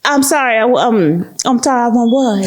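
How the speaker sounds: mock-shocked say what; shocked disbelief. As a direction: ask her to repeat it in disbelief. Shocked at prize name.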